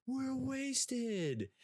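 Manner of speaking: high, overly calm fake drunk; mock TV drunk. Mocking TV shows, a high, prim fake drunk. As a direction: mimic a too-tidy movie drunk, up high. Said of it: "accurately portray drunk".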